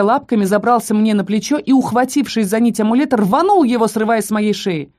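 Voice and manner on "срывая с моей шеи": ordinary delivery, nothing marked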